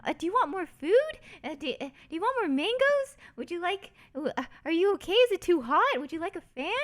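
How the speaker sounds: high excited doting relative; doting family. Retold or imitated. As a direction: fuss over the guest like a caring auntie. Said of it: "her family was just like".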